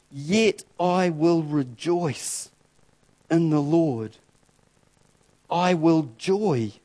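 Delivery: slow, solemn reading of scripture; slow, reverent recitation. Acted. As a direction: let each line land, slowly. Reads scripture slowly.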